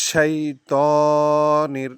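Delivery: শাইতানির slowly, with a hard hissing শ and a long তা; tajweed demo. Shown as a demonstration. Teacher modelling শাইতানির for students, slow and hissed. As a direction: lean on the শ of শাইতানির and hold the তা. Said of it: "শিনটা শক্ত করে উচ্চারণ করতে হবে".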